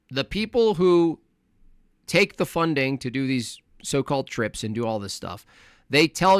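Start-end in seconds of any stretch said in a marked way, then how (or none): none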